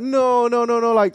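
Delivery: high, repeated protest; mock-aghast string of refusals; haggling refusal. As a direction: imitate a stallholder refusing a lowball. Vendor rejects the low offer.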